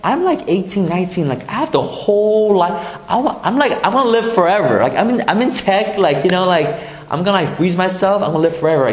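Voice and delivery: high, eager, mocking young techie; half-laughing mock young voice; young person voice. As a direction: play a carefree teen who thinks life is endless. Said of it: "For some of us, you're like".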